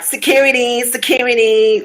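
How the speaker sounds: high-pitched imitation of summoning help; mock calling for security. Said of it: "and she's like".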